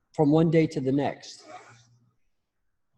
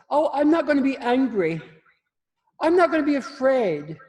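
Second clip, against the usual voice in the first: much higher, louder mocking voice; mock brave vow; 12 semitones higher, 8 dB louder than usual. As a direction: parody someone swearing off anger. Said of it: "everybody says".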